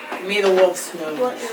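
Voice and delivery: usual speaking voice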